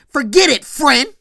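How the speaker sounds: imitating a heated brush-off; angry dismissal. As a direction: throw it back at him, loud and sharp. Johnny angrily dismisses Zach, acted.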